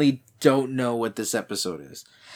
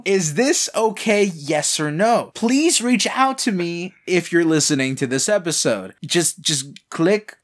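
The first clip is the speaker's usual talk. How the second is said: mock serious; slower than usual. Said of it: "ask a serious question".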